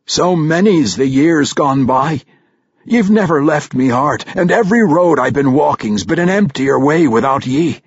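put-on an old dwarf pouring out his heart; Brunner's dwarf voice. A narrator's reading.

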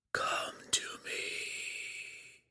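distant unvoiced whisper, slow; a far-off voice inside the head; whispery telepathic voice. A narrator's reading. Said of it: "came one Illithid's command".

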